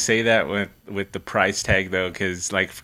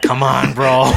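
egging on; 4 semitones higher, 8 dB louder, slower than usual. Retold or imitated. Friends egging Nando on, retold.

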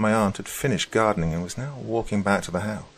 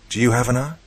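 lightly voiced child, higher and louder; a curious boy's question, pitched up; ten-year-old self; 5 semitones higher, 5 dB louder than usual. A narrator's reading. Boy asks the stranger about his aunt, light child voice. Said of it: "It was my ten-year-old self".

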